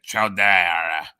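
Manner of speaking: a slow, drawn-out quoted cartoon voice; Simpsons character quote. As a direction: act out the cartoon line, dragging "ciao". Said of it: "in The Simpsons".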